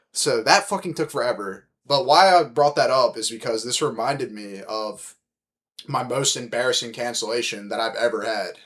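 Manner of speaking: ordinary talk, nothing marked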